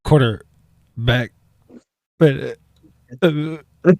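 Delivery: loud, slow, stammering mimicry; mock someone stalling out mid-word; exaggerated halting imitation of a glitching co-host; mock stroke victim. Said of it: "Sounds like he's actively having a stroke".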